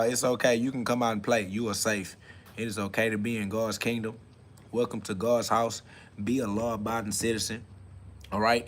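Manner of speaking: gently calm them down; soft reassurance. Real, not acted. Reassuring viewers they are safe.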